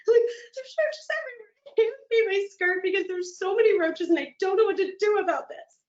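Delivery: high, near-crying panic; panicked wail. Retold or imitated. Panicking to the teacher about the roaches, a near-crying high wail. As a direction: wail it as if in tears. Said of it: "I'm like".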